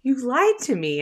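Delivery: imitated crying son. Crying son accusing his mom, imitated high hurt protest. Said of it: "just started crying".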